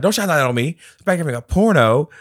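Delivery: loud, high, indignant yelling; mock yell at usher. Retold character yelling at the usher in the theater. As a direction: shout it at the flashlight beam.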